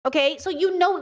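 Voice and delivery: ordinary delivery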